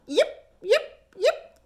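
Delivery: high fox calls; fox yipping. A narrator's reading. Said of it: "She called".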